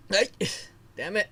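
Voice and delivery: hiccup breaking the words; annoyed with a hiccup. Real, not acted. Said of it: "Now I got hiccups".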